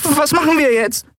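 very high-pitched character voice; anxious crew member. A narrator's reading. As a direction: ask it high and uncertain. (sehr hohe, unsichere Figurenstimme)

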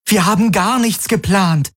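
high-pitched indignant outcry; crying out. A narrator's reading. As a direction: protest sharply, pitch way up. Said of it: "rief Kirai".

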